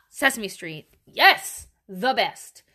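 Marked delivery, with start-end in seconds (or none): 1.0-1.7 a higher, louder burst of "Yes!"; excited yes. Real, not acted. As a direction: jump on it with real excitement. Rising excitement over Sesame Street.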